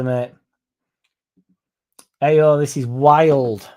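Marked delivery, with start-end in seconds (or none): 2.2-3.7 slow, drawn-out, louder "Ayo, this is wild"; stunned disbelief. Real, not acted. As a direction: let the shock sink in as you say it. Reacting to a viewer's comment.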